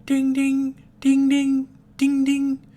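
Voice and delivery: high, loud electronic ding; high mechanical chime noise; pager beeping. Acted. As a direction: mimic a beeping device. Imitating the Oracle Pager beeping.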